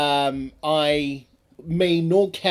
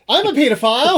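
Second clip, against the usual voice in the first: pitched-up and louder, laughing through it; squeaky laughing answer; high laughing character voice; 10 semitones higher, 8 dB louder than usual. Acted. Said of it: "Could a Peter File please come".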